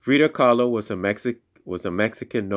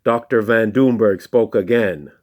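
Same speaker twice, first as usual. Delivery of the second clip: ordinary delivery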